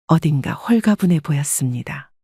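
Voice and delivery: ordinary talk, nothing marked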